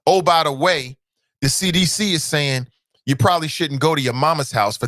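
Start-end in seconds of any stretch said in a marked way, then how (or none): none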